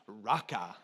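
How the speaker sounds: deep lion-king "Raka"; mock Mufasa "Raka"; Mufasa-style Raka. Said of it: "some of you said it like Mufasa".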